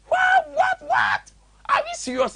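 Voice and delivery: very high incredulous squeal; hammed-up falsetto astonishment; mock disbelief.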